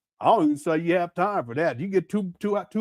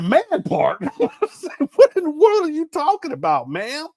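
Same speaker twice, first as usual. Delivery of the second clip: incredulous; 8 semitones higher, 3 dB louder than usual. Retold or imitated. Retold reaction to flight video.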